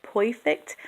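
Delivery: "perfect" with an oi in place of the er; Brooklyn oi for R. Shown as a demonstration. Giving an example of the R sound, "perfect" said poy-fect. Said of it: "can have an oi sound".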